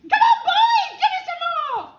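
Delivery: very high, very loud screech; demanding yell. A narrator's reading. Grandma ordering George to hurry, demanding yell. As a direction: yell impatiently at the boy. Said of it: "she yelled".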